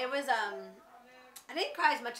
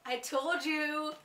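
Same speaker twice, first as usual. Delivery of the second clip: triumphant gloating; 5 semitones higher, 4 dB louder, much slower than usual. Retold or imitated. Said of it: "and I went".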